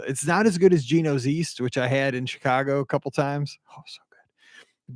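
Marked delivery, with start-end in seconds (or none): 3.7-4.9 whisper. Real, not acted. Savoring remembered pizza.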